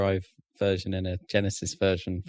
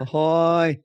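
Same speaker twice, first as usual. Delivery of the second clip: louder ahoy with a falling end; playful ahoy call; 5 semitones higher, 5 dB louder than usual. Shown as a demonstration. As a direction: hail with ahoy. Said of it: "it sounds like ahoy to me".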